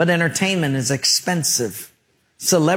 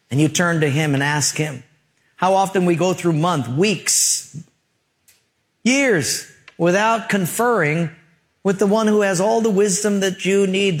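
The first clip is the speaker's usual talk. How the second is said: ordinary talk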